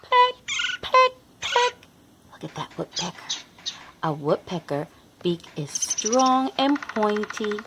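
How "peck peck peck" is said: pecking sound. Acted.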